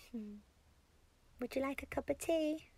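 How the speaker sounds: ordinary talk, nothing marked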